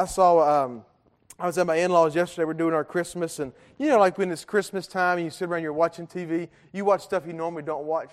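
ordinary talk

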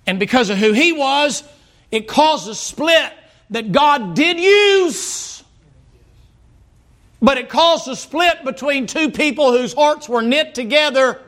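high, emphatic sermon cry; impassioned preaching. Real, not acted. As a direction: drive the point home from the pulpit. Preaches at full force.

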